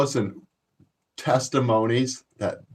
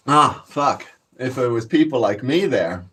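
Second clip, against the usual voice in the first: usual speaking voice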